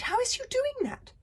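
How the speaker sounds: very high disbelief; shocked. Acted. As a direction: gasp the question. Librarian in shock.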